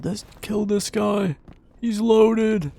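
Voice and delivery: high-pitched mock yell of a teammate; imitated panicked yelling. Player mimics enemy teammates on Discord, high mock yelling. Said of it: "probably yelling in Discord".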